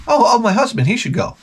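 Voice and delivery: high, eager mock wife voice; mocking wives. Comic mimicking the women on the street, high and eager. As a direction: chirp it out like a wife who volunteers her husband.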